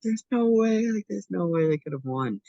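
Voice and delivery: high, loud cry of disbelief; freaking out. Retold or imitated. Friend shaken awake after losing track of his bet, freaking out. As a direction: imitate a man freaking out, pitch way up. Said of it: "like, freaking out".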